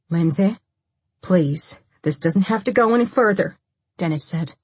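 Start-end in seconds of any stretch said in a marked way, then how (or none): 0.1-3.6 urgent appeal, pressing at the end; pleading. A narrator's reading. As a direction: plead with an armed woman. Dennis pleads with armed Lindsay.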